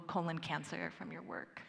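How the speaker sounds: normal talking voice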